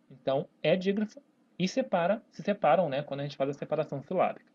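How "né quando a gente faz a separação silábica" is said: usual speaking voice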